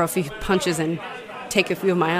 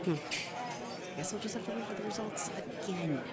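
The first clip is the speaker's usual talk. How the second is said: muttering to herself; 14 dB quieter than usual. Acted. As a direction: complain quietly where nobody hears. Bartender grumbling to herself alone.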